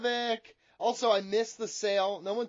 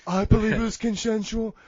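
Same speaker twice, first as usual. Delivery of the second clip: Big Johnny voice. Acted. Big Johnny dodges the host's question, evasive character voice. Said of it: "but Big Johnny".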